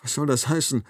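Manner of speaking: a touch breathless after coughing; out of breath. A narrator's reading. Doctor after a coughing fit, lightly breathless. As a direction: ask it short of air. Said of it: "Es klingt, als wäre er außer Atem".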